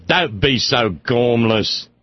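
slow, low Cambridge English scold; drawn-out English reproach over a cigarette; imitating an old English friend, slow English accent. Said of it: "she never lost the accent".

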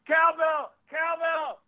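very high-pitched catchphrase call; mock calling out. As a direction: holler it high, in jest. Shouts out a catchphrase.

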